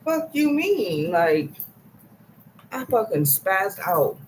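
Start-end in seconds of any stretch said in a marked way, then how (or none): 0.0-1.1 mock yell, much higher and slow; retold angry outburst. Retelling the stalker at her window, mock angry yell. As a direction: fire it back, indignant. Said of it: "I was like".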